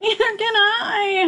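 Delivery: howled. A narrator's reading. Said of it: "howled Flitter".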